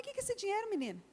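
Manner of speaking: high, mock-shocked question; a mother finding money in her daughter's bag; surprised mother. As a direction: sound startled by the money.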